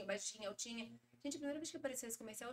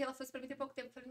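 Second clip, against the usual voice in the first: ordinary talk, nothing marked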